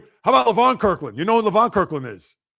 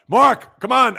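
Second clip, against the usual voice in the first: loud high call of a name; 6 semitones higher, 7 dB louder, much slower than usual. Real, not acted. Host calling out to a slow chatter, loud and high. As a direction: shout his name to hurry him.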